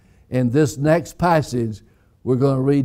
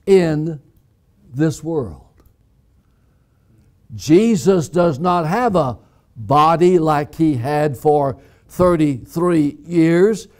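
ordinary talk, nothing marked

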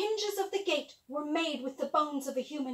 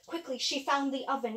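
ordinary delivery, nothing marked

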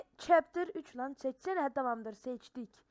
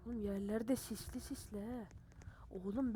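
whisper; 5 semitones lower, 12 dB quieter than usual. Real, not acted. Sneaking in scary level.

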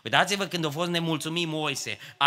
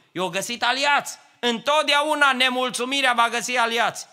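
higher, louder pulpit warning; fervent preaching; 8 semitones higher, 5 dB louder than usual. Acted. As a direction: drive the warning home to the church. Preaching rises to full force. (predică înflăcărată, mai sus și mai tare)

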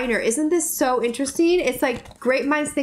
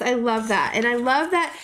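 ordinary talk

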